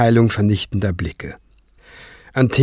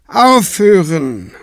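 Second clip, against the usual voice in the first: slow, sighing command; weary sigh; 7 semitones higher, slower than usual. Acted. High priest stopping the quarrel, slow and weary. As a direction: sigh it out, tired of them both. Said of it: "seufzte Tenebrae".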